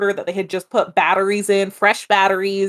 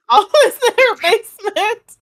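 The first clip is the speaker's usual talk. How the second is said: laughing; 13 semitones higher, 5 dB louder than usual. Acted. Laughing repeat of country line.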